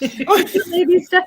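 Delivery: slow, high, laughing through it. Real, not acted. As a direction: giggle the words out.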